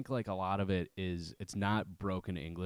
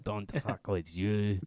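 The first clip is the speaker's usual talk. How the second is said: put-on slow, hammy French accent; drawn-out mock French accent; slower than usual. Copying the line for the friends, slow mock French accent. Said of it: "you do a French accent".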